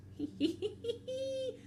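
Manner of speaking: high-pitched sneaky giggle; nervous cover-up laugh; sly snigger. Acted. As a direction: titter like a scheming cartoon snake. Snake covering up his slip.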